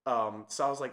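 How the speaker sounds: ordinary talk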